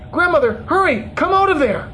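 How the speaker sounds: high-pitched urgent plea; urgent calling. A narrator's reading. Calling grandmother out of hell, urgent.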